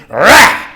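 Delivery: short, loud, high growled outburst; mock angry roar. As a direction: burst out with a wordless "rah". Wordless outburst replacing what he couldn't say.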